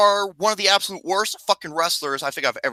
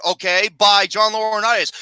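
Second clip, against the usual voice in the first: usual speaking voice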